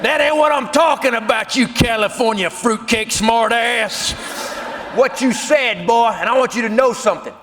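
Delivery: pissed-off Boss Hog. Retold or imitated. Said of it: "he is pissed off".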